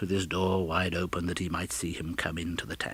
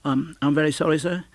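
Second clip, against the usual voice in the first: higher, louder nervous apology; apologetic clerk; 7 semitones higher, 6 dB louder than usual. Acted. Bob nervously apologising to Scrooge, apologetic. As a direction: stammer out an excuse.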